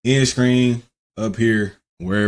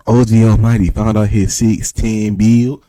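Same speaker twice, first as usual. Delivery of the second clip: hyped announcement; 8 dB louder, slower than usual. Real, not acted. Hyping up a friend's build.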